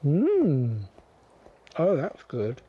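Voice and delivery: munching as she speaks; chewing while talking. Real, not acted. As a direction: mouth full of corn. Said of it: "Sorry for the munching".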